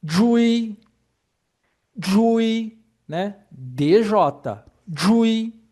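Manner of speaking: jui said high and loud as a model; model syllable. Shown as a demonstration. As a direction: announce jui clearly for the class. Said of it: "aqui tem outros exemplos". (jui pronunciado alto como modelo)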